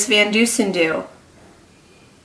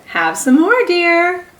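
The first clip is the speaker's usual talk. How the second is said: higher, sweetly coaxing offer; sweet mother voice; 10 semitones higher, 4 dB louder than usual. Acted. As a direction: coax like a doting mother. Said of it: "Missus Watson said".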